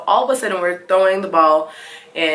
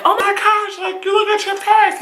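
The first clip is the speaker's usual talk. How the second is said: a friend's voice, pitched higher, in mock alarm; 13 semitones higher than usual. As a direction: play the startled friend, higher. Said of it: "She's like".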